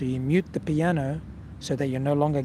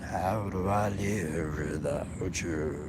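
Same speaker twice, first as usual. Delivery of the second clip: sung much lower, slow and soft; deep voice; 8 semitones lower, 6 dB quieter, much slower than usual. Shown as a demonstration. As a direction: sing it way down low. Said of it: "your really deep voice".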